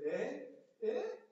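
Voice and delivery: high, teasing brag; cocky taunt. Pretend. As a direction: show off to the others. Peter showing off.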